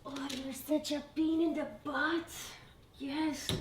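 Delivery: fond grumble at the pesky dog; talking to the dog. Real, not acted. Scolding the dog who interrupts.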